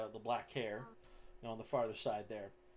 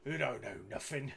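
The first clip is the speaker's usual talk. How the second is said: taunting Goliath; 2 semitones higher than usual. Acted. Goliath answers the fighter's taunt, goading brute voice. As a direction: square up and challenge him in character.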